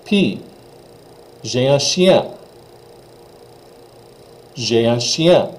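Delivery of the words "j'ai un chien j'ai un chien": J'ai un chien, ch like she, nasal ien; teacher demo; French pronunciation. Shown as a demonstration. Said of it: "this is she".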